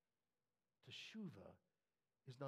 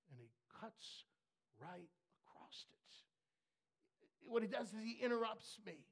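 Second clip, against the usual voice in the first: ordinary talk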